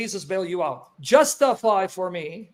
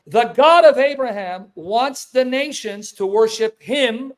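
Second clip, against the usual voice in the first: loud, drawn-out, deliberate words; slow, emphatic repeat; 2 semitones higher, 4 dB louder, much slower than usual. Real, not acted. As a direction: spell it out slowly and forcefully. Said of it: "Let me repeat it again slowly".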